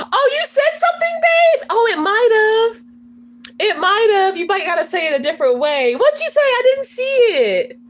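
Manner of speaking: high, animated reply to a viewer; excited questioning. Real, not acted. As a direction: pipe up eagerly to ask what was said. Realises a viewer's comment was missed.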